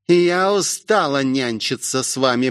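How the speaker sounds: plain reading voice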